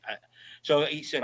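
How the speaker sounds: ordinary talk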